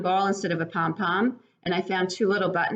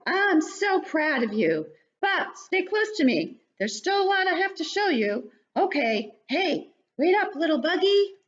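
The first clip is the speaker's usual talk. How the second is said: much higher, louder cheering bug voice; cheering parent bug; 13 semitones higher, 3 dB louder, slower than usual. Acted. Parent bug cheers the baby flying, high and excited. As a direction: cheer the little one through its first flight.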